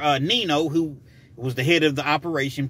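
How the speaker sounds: ordinary talk, nothing marked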